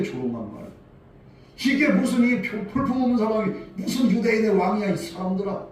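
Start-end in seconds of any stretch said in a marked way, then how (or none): 1.6-5.7 higher, louder mocking jab; jeering. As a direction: sneer at the crowd too. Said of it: "같이 까는 거예요".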